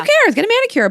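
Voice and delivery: high, dismissive onlooker's advice; breezy mock advice. Childless friend advising a busy mom, breezily dismissive. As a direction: toss it off high and carefree, as glib advice.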